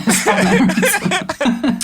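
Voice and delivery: high, slow laughing words. Real, not acted. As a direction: laugh through it. Laughing apology.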